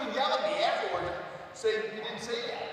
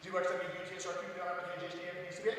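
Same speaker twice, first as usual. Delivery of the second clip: very fast, softer backwards alphabet; world championship speed; 2 semitones lower, 8 dB quieter, much faster than usual. Shown as a demonstration. Champion showing contestants the backwards alphabet, world championship speed. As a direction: rattle the letters off at top speed. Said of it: "World Championship speed".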